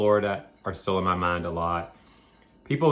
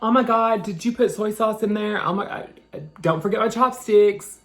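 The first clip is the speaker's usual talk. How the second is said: high, loud, gushing customer voice, pitched way up; 12 semitones higher, 5 dB louder than usual. Retold or imitated. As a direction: ham up the fussy customer fretting over soy sauce and chopsticks.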